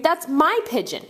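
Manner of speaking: high, urgent cry; screamed protest. A narrator's reading. Boy defends his pet bird, high alarmed cry. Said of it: "Tony screamed".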